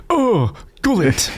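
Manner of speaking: high, slow mock stomach-ache moan; hammed-up groan over a bad gullet; pained groan. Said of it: "Like he's got a bad stomach".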